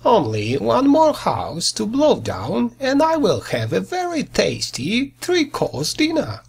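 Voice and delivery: gloating wolf. Acted. Wolf gloats over dinner.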